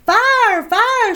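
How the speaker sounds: very high panicked scream. Acted. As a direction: shriek the alarm. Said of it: "she screamed".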